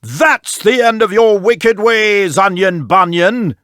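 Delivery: slow angry king's cry; king shouting at the viper; mild shout. Acted. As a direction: fling the words after the snake.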